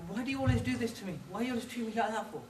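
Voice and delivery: high, fast mock whining wife; hammed-up high whine, laughing at the end; whiny wife. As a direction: play the wounded wife, high and quick. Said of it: "And she says".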